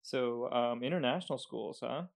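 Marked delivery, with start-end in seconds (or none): none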